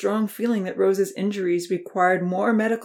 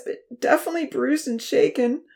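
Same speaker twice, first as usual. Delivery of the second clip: crying; 8 semitones higher than usual. A narrator's reading. Uncle reassuring through tears, crying. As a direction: speak through welling tears. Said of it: "as tears welled up in his eyes".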